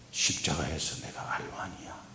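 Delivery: soft unvoiced whisper; whispered voice of God. God quietly telling the pastor he is R one. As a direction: barely breathe the words. Same words repeated, growing.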